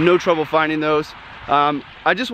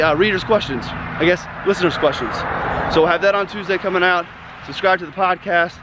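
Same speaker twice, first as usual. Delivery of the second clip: ordinary talk, nothing marked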